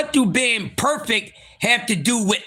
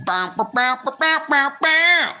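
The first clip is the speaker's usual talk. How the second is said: spelled-out air horn, climbing higher; mock horn blasts; 5 semitones higher than usual. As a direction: blare it out like a hype horn, last one highest.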